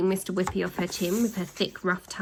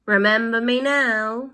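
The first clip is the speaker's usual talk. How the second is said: slow, louder call; 3 semitones higher, 6 dB louder than usual. Acted. Boy calls to the villains, slow and loud. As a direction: call out to them slowly. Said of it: "called Ned".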